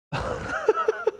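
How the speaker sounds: soft high giggling; laugh. Real, not acted. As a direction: giggle at your own bit. Laughs after joke.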